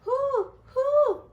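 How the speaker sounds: high, loud hooting; owl hoot. Acted. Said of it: "asked the owl".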